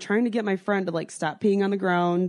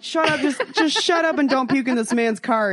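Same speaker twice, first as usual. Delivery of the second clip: higher, a mild mock snap at a drunk friend; exasperated scolding; 6 semitones higher, 4 dB louder than usual. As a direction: scold her to hush, half-joking. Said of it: "I'm like".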